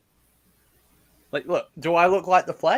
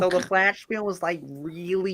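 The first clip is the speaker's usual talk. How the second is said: American accent; 4 dB quieter than usual. Shown as a demonstration. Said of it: "American accent like this".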